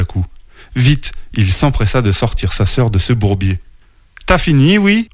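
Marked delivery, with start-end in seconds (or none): 4.3-5.1 high-pitched, irritated grumble; grumbling. A narrator's reading. Little bear pulling his sister from the mud, grumbling. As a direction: grouse like an annoyed little brother. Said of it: "ronchonna-t-il".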